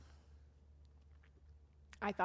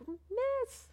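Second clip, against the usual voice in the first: high, loud call across; kids calling out; 5 dB louder than usual. Retold or imitated. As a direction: shout her name from afar.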